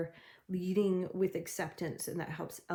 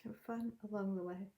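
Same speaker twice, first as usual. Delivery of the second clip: fun said softly and fast, like an afterthought; soft, quick repeat; 2 semitones higher, 7 dB quieter, faster than usual. Shown as a demonstration. Reader repeating her advice softly to viewers. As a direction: echo the line quietly to yourself. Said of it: "repeats the line softer".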